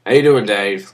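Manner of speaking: new GM breezily greets Batista amid the chaos; most casual greeting. Retold or imitated. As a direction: greet him like the most ordinary thing. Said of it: "Like, the most casual thing in the world".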